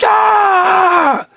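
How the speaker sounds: very high, drawn-out scream; retold scream. Speaker on hearing it's the church, long scream. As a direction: let out a long, high shriek. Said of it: "I remember screaming".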